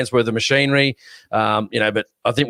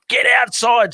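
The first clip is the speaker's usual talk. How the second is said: urgent call out; 10 semitones higher than usual. Retold or imitated. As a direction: yell to wake your mate, urgent and sharp. Tapping window to wake sleeping mate.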